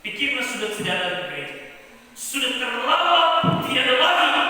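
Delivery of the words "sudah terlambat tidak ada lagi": loud, high, slowly dragged exasperation; angry despair. Retold or imitated. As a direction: wail that it's all too late. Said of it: "Dia marah, semakin marah". (teriakan marah tinggi dan lambat)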